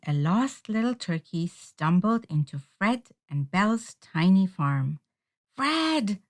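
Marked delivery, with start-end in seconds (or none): 5.5-6.2 called out high; calling out. A narrator's reading. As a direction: call him across the farm. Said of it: "Belle cried".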